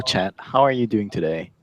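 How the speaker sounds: ordinary talk, nothing marked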